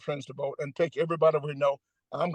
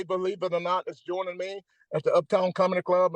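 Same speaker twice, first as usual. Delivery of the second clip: ordinary delivery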